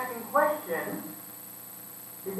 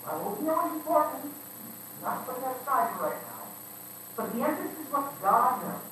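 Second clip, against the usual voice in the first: ordinary talk, nothing marked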